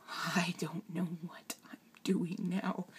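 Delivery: hushed, whispery confession; almost whisper it, choked with worry; very soft, fearful admission; scared, near tears. Real, not acted. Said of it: "that really scares me".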